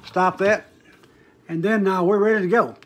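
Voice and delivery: ordinary talk, nothing marked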